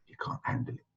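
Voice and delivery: hushed confidential whisper. Retold or imitated. Senior student warns a classmate about the sheikh, whispering. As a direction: whisper a discreet warning to the person beside you. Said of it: "he whispers to me and says".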